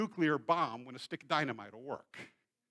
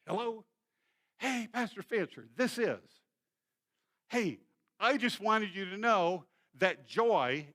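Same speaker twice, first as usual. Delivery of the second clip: higher, upset church member on the phone; caller voice; 5 semitones higher, 6 dB louder than usual. Retold or imitated. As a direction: play the hurt caller, slow and loud. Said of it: "she was calling".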